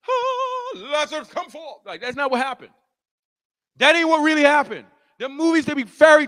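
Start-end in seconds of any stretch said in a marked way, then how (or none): 0.1-1.8 high, airy mock-dramatic call. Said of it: "like in the, in the movies, you know, fake".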